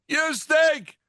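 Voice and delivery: boo him with a punchy yell; mock disgusted shout. Ranting at the Bears coach, mock disgust.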